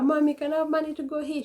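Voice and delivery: high, fast pleading; begging kid. Retold or imitated. Child begging mom for outing money, high and fast. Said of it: "Every time, but like".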